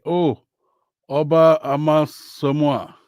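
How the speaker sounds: ordinary delivery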